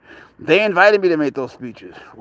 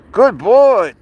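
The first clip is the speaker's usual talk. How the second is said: high, loud praise for the cat; excited pet praise; 6 semitones higher, 6 dB louder than usual. Real, not acted. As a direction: burst of praise like cheering a pet.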